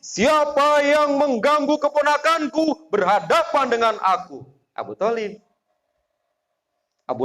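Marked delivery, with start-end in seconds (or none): 0.0-4.4 protective challenge. Retold or imitated. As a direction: stand guard over your nephew. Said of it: "Kalau Abu Thalib". (Abu Thalib menantang siapa saja yang mengganggu keponakannya)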